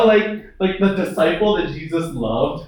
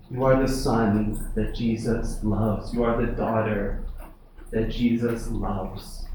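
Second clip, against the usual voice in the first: softer, lower, hushed ministering tone; gentle preaching; 4 semitones lower, 3 dB quieter than usual. Acted. Soft ministering passage, God's voice.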